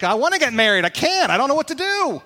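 high, helpless protest; acted exasperation. Single person protesting to the preacher about marriage, exasperated. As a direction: climb in pitch like someone at a loss.

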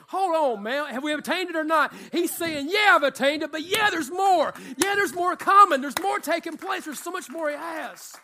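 loud, high, shouting preacher build; full-force preaching. Acted. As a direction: preach it out loud to the whole church.

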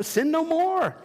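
mock verdict. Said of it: "I go".

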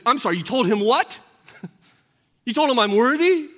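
you told him WHAT, shocked and amused; incredulous centurion. Acted. Centurion hears leaders' boast.

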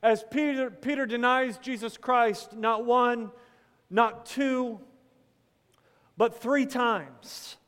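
preaching at full force; preaching build-up. Acted. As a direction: drive the count home from the pulpit.

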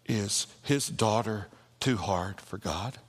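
usual speaking voice

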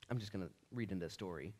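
usual speaking voice

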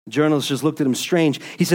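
ordinary talk

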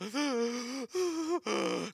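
imitated child's sobbing gasps; hyperventilating gasps. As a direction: gasp like a kid who cried too hard. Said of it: "like they're hyperventilating".

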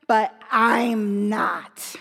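low, very slow, hurt refusal; the older brother's low, slow, wounded grudge; bitter and hurt. Acted. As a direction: drag it out low, sulking.

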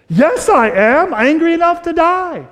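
high, loud mock outburst, drawn out; hammed-up whiny Jonah yelling back; angry Jonah. Preacher voicing Jonah snapping back at God, mock angry whine.

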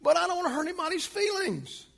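mock timid excuse.

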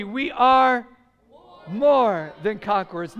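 loud, rallying declaration; like you believe it. Shown as a demonstration. Preacher leading the congregation to say it again, loud and convinced. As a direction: proclaim it loudly with full belief. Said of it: "say it like you believe it".

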